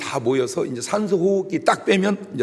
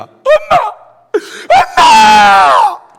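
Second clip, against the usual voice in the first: wailing "엄마!" at a funeral, very high and loud; a daughter howling "엄마!" for her dead mother; 14 dB louder, much slower than usual. Shown as a demonstration. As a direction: cry out "엄마!" like a grieving daughter. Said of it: "딸은 이렇게 웁니다".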